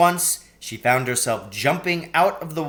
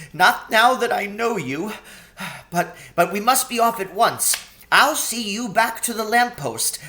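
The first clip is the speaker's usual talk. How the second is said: high, earnest faun voice with stammers; nervous but resolved faun; stammering faun; 8 semitones higher, 4 dB louder than usual. A narrator's reading. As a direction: Tumnus promises Lucy to take her back to the lamppost.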